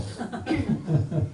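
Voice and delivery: real chuckle after a joke; short trailing laugh; amused laugh. Real, not acted. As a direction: laugh lightly with the congregation. Laughs at congregation's answer.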